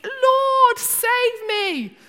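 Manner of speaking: high, loud panicked plea; shouted "Lord, save me"; desperate cry for help. Acted. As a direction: cry out like a sinking man. Peter crying out while sinking.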